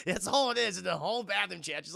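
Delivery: normal talking voice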